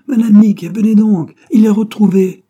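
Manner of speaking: loud, high call across the house; joyful shouting. A narrator's reading. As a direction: yell for Madame Mickey to come. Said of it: "Michel Pernet criait".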